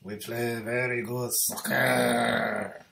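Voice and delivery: slow, hammed-up French accent; exaggerated French accent, drawn out; mock French accent. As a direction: lay on a cartoon French accent. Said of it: "let me do a French".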